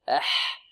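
أح with a thin, airy ح; thin vowel before the ح in أح; light haa. Shown as a demonstration. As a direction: let أح out lightly on the breath. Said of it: "والحاء مرققة".

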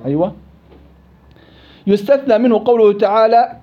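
ordinary delivery

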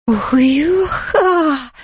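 high, very slow moan; ghostly moan. A narrator's reading. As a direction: moan long like a grieving ghost. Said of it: "стонал Хуга".

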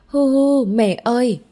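put-on high, loud wail of a little girl; child sobbing. A narrator's reading. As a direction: sob it out and cling to mum. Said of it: "vùi vào trong lòng". (bé gái khóc hu hu gọi mẹ)

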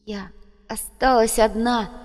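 high, slow anguished cry; distraught, pitched high; horrified realization. A narrator's reading. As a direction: draw it out in dawning horror. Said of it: "складывались в одну кошмарную фразу".

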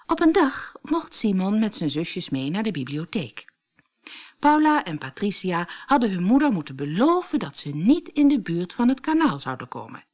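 ordinary delivery

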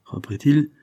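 ordinary delivery

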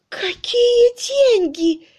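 very high, drawn-out, gasped question; gasping. A narrator's reading. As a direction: squeeze it out slowly, short of breath. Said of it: "прошептала, хватая ртом воздух".

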